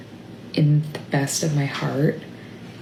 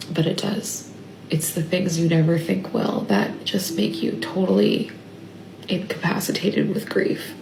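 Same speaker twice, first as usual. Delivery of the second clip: tearful, breaking up with sadness; real grief; 3 semitones higher, 3 dB quieter than usual. Real, not acted. Parent sad for their kids, spiralling over little things, tearful and grieving. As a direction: struggle to speak through real grief, with long halting pauses. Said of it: "I just am so sad".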